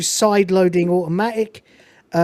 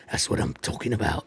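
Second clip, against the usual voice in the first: very low, soft imitation of a movie hero; Nicholas Angel; 11 semitones lower, 10 dB quieter than usual. Narrator repeats Angel's catchphrase, low put-on hero voice. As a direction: lower right down for his one-liner. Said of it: "he says in a British accent".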